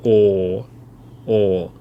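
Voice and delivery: "o" held as one long steady vowel. Shown as a demonstration. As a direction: draw out "o" with no u at the end. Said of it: "have a long o instead of".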